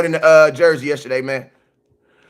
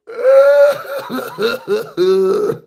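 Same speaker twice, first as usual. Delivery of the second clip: mock crying; 11 semitones higher than usual. Fan breaks down over the trade, hammed-up sobbing. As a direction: break into exaggerated wailing. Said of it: "am not crying".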